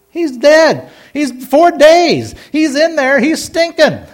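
high, emphatic objection in short bursts; hammed-up, high-pitched objection; acted protest. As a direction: protest each point, pitched well up. Acts objectors answering Jesus at tomb.

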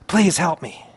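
softly voiced plea to the Lord; a hushed, understated plea for help; pleading prayer. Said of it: "I plead with the Lord".

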